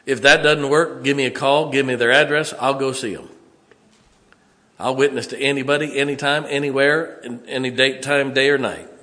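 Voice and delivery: loud, high, emphatic pulpit voice; fiery preaching. Acted. Pastor telling the congregation he'll witness anytime, booming preaching. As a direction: hammer every promise home.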